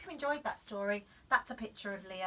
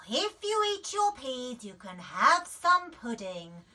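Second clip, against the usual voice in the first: coaxing mum; 3 semitones higher, 8 dB louder than usual. A narrator's reading. Mum bribing Daisy with pudding, coaxing. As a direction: coax like a mother bribing. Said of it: "said Mum".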